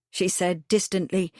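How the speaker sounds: ordinary delivery, nothing marked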